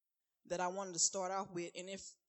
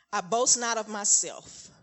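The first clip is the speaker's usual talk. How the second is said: prophetic declaration; 3 semitones higher, 8 dB louder, slower than usual. Acted. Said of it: "So I'm gonna read to you what I wrote".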